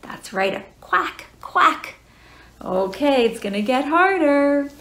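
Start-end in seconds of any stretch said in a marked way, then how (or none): 0.5-1.9 duck quack. Shown as a demonstration. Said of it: "what our duck says".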